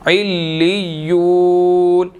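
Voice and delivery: इल्लियून chanted slowly, with ain joined to lam and ya; slow Quranic recitation. Shown as a demonstration. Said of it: "आइन ने लाम के साथ भी मिलना है और या के साथ भी मिलना है".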